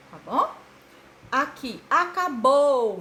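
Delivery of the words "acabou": "acabou" slowed, with BOU stretched out and loud; slow teacher's demo, "acabou" with a drawn-out BOU; stress on BOU. Shown as a demonstration. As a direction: lean long on the "bou" of "acabou". Showing tonic syllable by lengthening.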